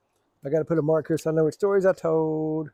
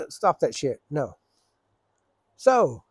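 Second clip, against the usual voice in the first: normal talking voice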